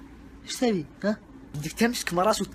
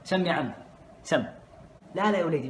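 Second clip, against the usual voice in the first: normal talking voice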